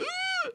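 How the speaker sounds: sharp gasp for air after a rib shot; mock gasp of pain; winded gasp. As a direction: gasp like the wind was knocked out of you. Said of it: "I was like".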